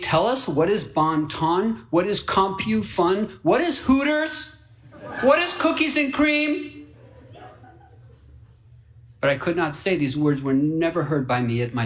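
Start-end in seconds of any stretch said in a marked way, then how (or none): 0.0-6.9 puzzled fox. Retold or imitated. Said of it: "Like Fox eight".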